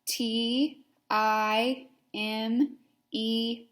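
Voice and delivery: each letter spelled out slowly; slow spelling. Shown as a demonstration. Teacher leading children in slow spelling.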